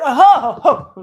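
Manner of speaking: hammed-up climax cries; mock ecstatic moan. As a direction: gasp as if overcome, half laughing. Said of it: "that feeling, that right there".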